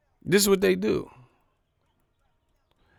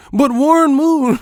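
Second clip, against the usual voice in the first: mock pundit listing; 9 semitones higher, 7 dB louder than usual. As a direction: list the names like a clueless pundit.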